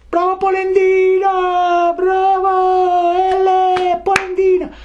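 very high, drawn-out piping cry; little voice crying out. A narrator's reading. Mysterious little voice taunting Geppetto, very high drawn-out cry. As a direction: pipe it out in a tiny, stretched voice. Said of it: "gridò la solita vocina".

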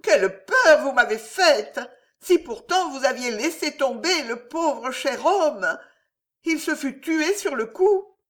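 high, exclaiming female figure; innkeeper's wife. A narrator's reading. Wife chiding the knight over her husband's peril, high-pitched.

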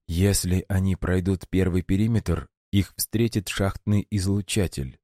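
ordinary delivery, nothing marked

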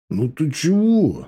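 displeased, stretched-out objection; frowning protest. A narrator's reading. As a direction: drag it out, a bit offended. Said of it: "нахмурился Лёня".

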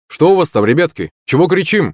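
high, lilting call to youngsters. A narrator's reading. Father by the campfire to the kids, calling out. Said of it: "окликнул нас батя".

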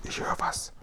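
unvoiced, very soft whisper. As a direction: whisper a warning so no one hears. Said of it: "flüsterte der Guri zurück".

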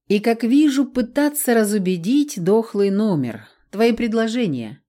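ordinary delivery

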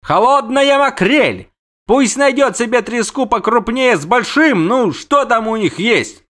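high, loud angry rant; outraged insult. Acted. Prince insults the siren back, outraged.

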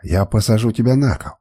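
usual speaking voice

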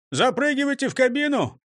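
high-pitched call. Acted. Driver hurries the robbers into the car, calling out high. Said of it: "крикнул Крендель".

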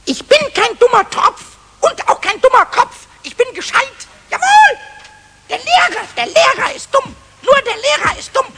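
loud, defiant and offended; indignant outburst. Acted. Goblin furious at the teacher, indignant shout. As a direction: stamp your foot and shout it out.

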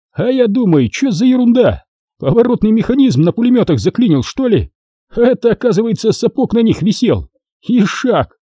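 high-pitched animated outburst; excited retelling. A narrator's reading. Turkmen jumping in to tell about the jammed machine gun, excited. Turkmen jumps in with amused tale.

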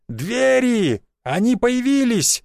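high, drawn-out cry of discovery; shouting. A narrator's reading. Narrator stopping the bloodshed as doors appear, shouting. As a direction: call it out high to everyone. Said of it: "закричал я".

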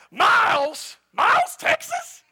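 loud squeal of incredulity, shooting very high; hammed-up scornful astonishment; mock disbelief. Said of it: "it'd be like today saying".

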